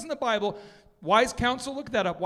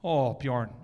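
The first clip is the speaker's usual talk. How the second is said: lower, drawn-out Minnesota vowels; Minnesotan accent; 5 semitones lower than usual. Acted. Presenter addressing Bjorn like a local, Minnesota accent. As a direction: put on a broad Minnesotan accent. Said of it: "everyone in Minnesota is Bjorn".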